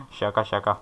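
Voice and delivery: quiet giggle through just kidding; soft laughing just kidding. Real, not acted. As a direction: laugh it off softly. Jokes about faking AFK.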